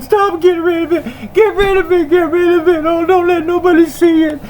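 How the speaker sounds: high, frantic squeak of a caught liar; panicked whiny voice. Retold or imitated. Caught liar begging to hide the video, high and frantic.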